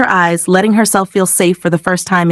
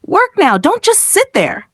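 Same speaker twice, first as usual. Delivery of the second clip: harsh stepmother; 11 semitones higher than usual. Acted. Stepmother orders child.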